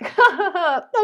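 a high, loud laugh breaking out; burst of laughter. Retold or imitated. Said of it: "eventually I began to laugh".

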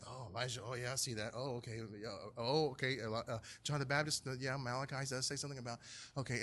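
soft, murmured pondering; half to yourself, mulling it over; thinking aloud. Acted. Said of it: "they're all thinking".

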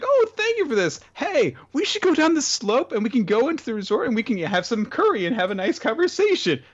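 put-on very high, cheery girl voice; bubbly girl. Girl inviting the villain to curry, very high and cheery. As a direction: gush like a carefree girl planning lunch. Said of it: "it's like".

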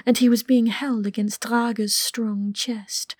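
usual speaking voice

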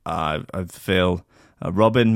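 ordinary delivery

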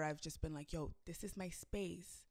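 ordinary talk, nothing marked

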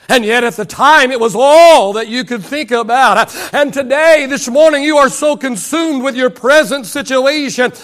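impassioned sermon delivery; rolling pulpit cadence; preaching. Real, not acted. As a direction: preach it with driving force, stretching key words. Preaches at full force.

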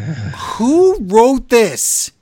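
high, loud, drawn-out wail; exasperated outcry. Real, not acted. Fan fed up with wrestling storyline, exasperated wail. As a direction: stretch each word out in loud disbelief.

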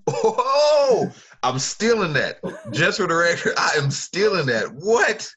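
loud, high-pitched whoop of approval; amazed delight. Real, not acted. Host floored by the guest's line, loudly thrilled. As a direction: blown away, exclaim it big and high.

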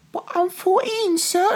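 high, put-on young girl's voice; a squeaky little kid protesting; voicing the daughter. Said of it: "And the kid's like".

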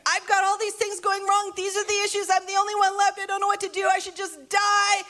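whiny Elijah. Retold or imitated. Elijah pouring out his despair to God, high, whiny, building to a shout. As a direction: wail your troubles like a worn-out prophet.

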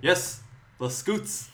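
slow Northern Irish sketch reply; comic Northern Irish punchline; Northern Irish accent. Shown as a demonstration. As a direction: draw it out in broad Northern Irish. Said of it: "an Nordy one".

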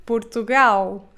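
Portugal with a clear final L, puɾtuɣal; European Portuguese Portugal. Shown as a demonstration. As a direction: end Portugal on a real L sound. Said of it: "nós dizemos".

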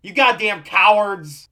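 loud, slow outraged yell; furious shout. Acted. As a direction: scream it at the deserters. Yelling after they hang up.

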